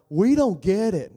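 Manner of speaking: confused Peter. Retold or imitated. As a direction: whine it like a lost disciple. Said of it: "poor guy, he's like".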